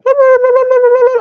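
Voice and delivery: very loud high-pitched cackle; shrill loud giggle burst; loud high child laugh. Retold or imitated. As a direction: mimic a child shrieking with laughter. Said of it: "he's like".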